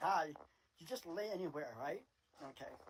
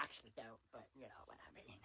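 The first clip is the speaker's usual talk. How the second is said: whispered aside; 7 semitones lower, 14 dB quieter than usual. Real, not acted. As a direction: whisper it, much softer. Aside after "I fear God.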